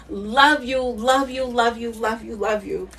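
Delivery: fading tender repeats. Real, not acted. Repeating love you, fading.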